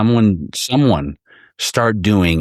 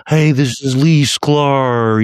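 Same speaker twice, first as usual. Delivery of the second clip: slow, high mock intro, much louder; hammed-up slow drawl of a bass player's intro; 7 semitones higher, 4 dB louder, much slower than usual. Drummer mocking a bassist's video greeting, slow, high and loud. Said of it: "start mocking other musician people in their way".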